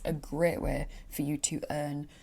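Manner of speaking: normal talking voice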